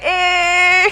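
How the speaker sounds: high, loud excited cry of Hey; mom's loud cry. Retold or imitated. Mom bursting in on her crying daughter, loud high cry. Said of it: "she was like".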